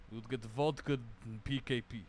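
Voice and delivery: put-on a playful Russian accent joke; mock Russian accent. Gamer joking about vodka, in a light mock Russian accent. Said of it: "love saying it in a Russian ac-, accent".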